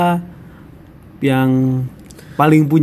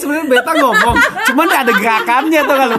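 laughing; 11 semitones higher than usual. Real, not acted. Describing the manager's gimmick.

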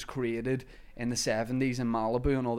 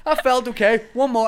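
high, loud mimicking through laughter; mock your own take while cracking up; laughing self-mimicry; 10 semitones higher, 7 dB louder than usual. Actor quoting own audition tape to friends, laughing. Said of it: "I like the way I'm like".

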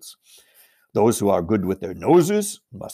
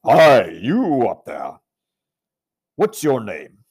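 slow, loud call to someone far above; bawling up; 7 dB louder, slower than usual. Acted. Aslan calls up to the giant, bawling loudly. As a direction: bawl it up the wall at a giant. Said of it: "he bawled up at the giant".

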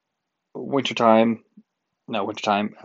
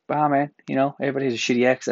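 ordinary talk

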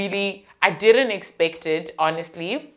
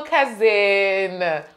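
usual speaking voice

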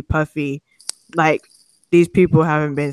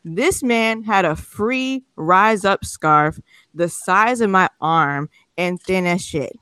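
normal talking voice

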